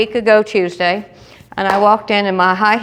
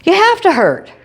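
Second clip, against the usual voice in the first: insistent surgeon; 9 semitones higher than usual. Retold or imitated. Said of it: "He goes".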